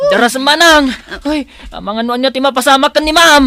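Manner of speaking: loud, fast, urgent plea; panicked fast shout; frantic urging. Acted. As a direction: hurry her along in a panic. Urging hurry.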